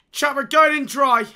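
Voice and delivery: loud hyped announcement; shout. Real, not acted. Streamer announcing to chat he goes in, loud shout. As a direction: shout the decision.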